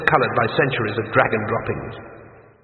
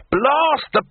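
much higher, cut-off curse; cursing; 13 semitones higher, 5 dB louder than usual. Acted. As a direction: start an oath and stop short. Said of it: "began the wizard and froze".

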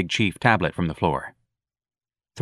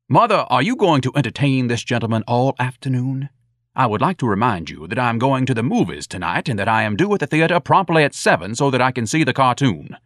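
haughty, raised-pitch formal speech; pompous, put-upon son; 5 semitones higher, 3 dB louder than usual. Acted. Ignatius reminding his mother of his plans, pompous. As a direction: lecture in a self-important higher voice.

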